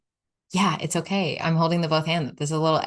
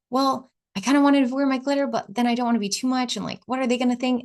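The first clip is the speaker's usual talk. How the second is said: nervous, unsure; 7 semitones higher, faster than usual. Retold or imitated. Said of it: "I was like".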